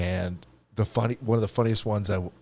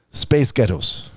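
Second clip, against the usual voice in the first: Scottish accent; 5 dB louder, much slower than usual. Shown as a demonstration. Host demonstrating the Scottish test phrase, Scottish accent. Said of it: "speak with a Scottish accent".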